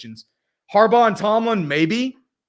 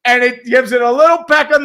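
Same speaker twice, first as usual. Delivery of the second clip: loud, slowed-down replay call; excited replay call; 9 semitones higher, 9 dB louder, slower than usual. Real, not acted. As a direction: savor the replay like a hyped commentator. Announcer replay description.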